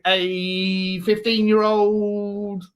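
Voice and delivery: drawn-out, high-pitched mock Leeds accent; exaggerated Leeds accent, stretching the words; Archie Gray. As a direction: put on a teenage lad from Leeds. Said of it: "that's what he probably sounds like".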